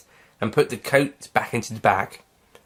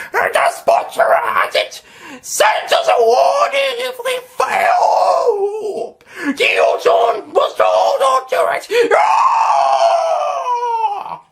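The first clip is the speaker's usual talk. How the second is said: very high, loud, slow shrieking alien; pained alien creature; 6 dB louder, much slower than usual. Acted. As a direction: cry out through the agony. Nestene in pain.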